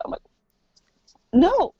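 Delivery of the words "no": loud, high retold objection; mock-indignant no; indignant refusal. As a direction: shoot it down, pitched up. Objecting to the doctor's explanation.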